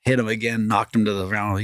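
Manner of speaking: ordinary delivery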